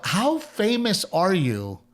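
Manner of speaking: high, drawn-out amazed question; incredulous. Retold or imitated. Comedian marvelling to himself at the director, incredulous. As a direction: stretch it slowly in mock disbelief. Amazed inner thought about Apatow.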